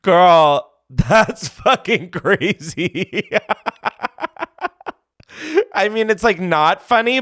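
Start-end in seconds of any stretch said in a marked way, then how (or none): 0.0-3.3 slow, laughing through the words. Real, not acted. Host reacting to the caller's story, slowly laughing the words. 3.3-4.9 trailing laugh. Real, not acted. Host keeps laughing.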